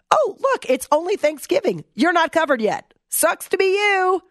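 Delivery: high, gloating sing-song taunt; mock taunt. Host mocking the pamphlet writer, gloating taunt. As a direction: rub it in with fake sympathy. Taunting someone not yet covered.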